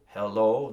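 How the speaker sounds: "hello" with a loud, stressed final O; accent the second syllable of "hello". Shown as a demonstration. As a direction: punch the O at the end of "hello". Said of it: "the O is, is the accent".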